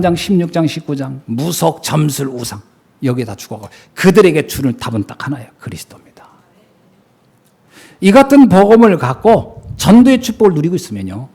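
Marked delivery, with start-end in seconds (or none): 5.6-6.3 breathy hushed whisper of the key word; whispered answer. Real, not acted. Preacher whispering the answer to the congregation. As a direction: drop to a whisper to land it.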